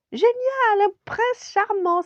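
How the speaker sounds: very high, loud squeal of delight; excited princess cry. A narrator's reading. Princess thrilled to have found a prince charmant, excited cry. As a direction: shriek it in a high girlish princess voice. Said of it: "s'écria alors la jeune princesse".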